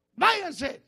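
sudden high, loud order; shouted command. Acted. Preacher acting the Lord casting out demons, shouted command. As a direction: call out a forceful dismissal.

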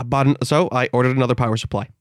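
usual speaking voice

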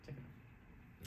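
slow breathy whisper. Shown as a demonstration. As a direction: whisper it slowly. Repeats the phrase, then whispers it.

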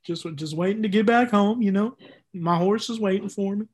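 put-on Texas farm-boy accent; easygoing Texas country accent. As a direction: lay on the homesick Texan accent. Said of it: "do, like, a Texas accent".

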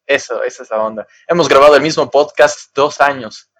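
ordinary talk

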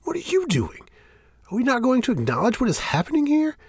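very high, strained disbelief; shrill demand for the truth; outraged. Acted. Shane outraged at Fel.

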